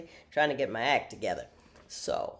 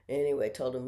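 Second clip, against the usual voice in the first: ordinary talk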